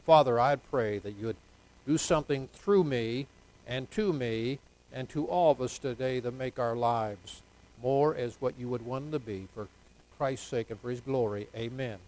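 soft, earnest closing prayer. Real, not acted. Pastor prays over the congregation, soft and earnest. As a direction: pray it quietly to the Father.

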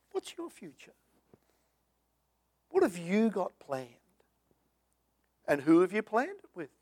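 ordinary delivery

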